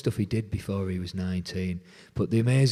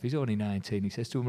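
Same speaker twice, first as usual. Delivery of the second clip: usual speaking voice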